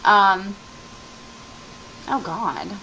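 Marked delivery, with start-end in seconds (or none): none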